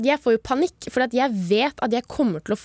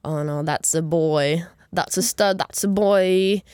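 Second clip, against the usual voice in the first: a hint of gossipy old ladies; 5 semitones lower, slower than usual. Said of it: "eldre damer ... titter".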